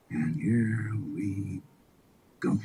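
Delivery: very low, slow and soft; weary resignation. Acted. Onlookers watching Florida about to copy Texas, weary resignation.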